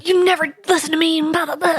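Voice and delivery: high, hushed mock argument; playful whisper-shout quarrel; whisper yelling. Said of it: "Like a whisper yelling".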